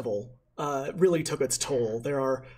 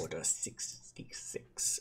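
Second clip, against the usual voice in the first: whisper; 11 semitones lower, 15 dB quieter, slower than usual. Acted. Whispered Star Wars reference.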